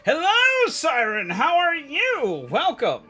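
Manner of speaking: high, loud, sing-out welcome; excited greeting. Real, not acted. Greeting a newcomer to the stream.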